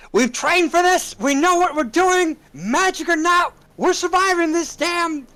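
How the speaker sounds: rallying shout to the crew; shouting over the storm. Acted. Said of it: "shout over the noise to the crew".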